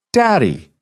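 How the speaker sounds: put-on high, sweet little-girl cry of Daddy!; little girl's call. Little girl greets her dad on video call, high and sweet. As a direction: chirp Daddy! like a happy five-year-old. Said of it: "The voice was high and sweet".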